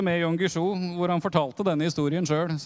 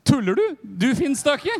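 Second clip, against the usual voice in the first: incredulous retort; 7 semitones higher, 5 dB louder than usual. Retold or imitated. Pastor's disbelieving retort.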